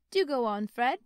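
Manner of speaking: loud, bright clapping plea; eager. Acted. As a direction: urge him on delightedly. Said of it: "clapping her hands".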